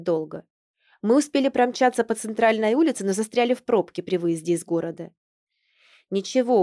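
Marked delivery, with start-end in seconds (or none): none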